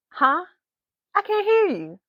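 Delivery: ordinary delivery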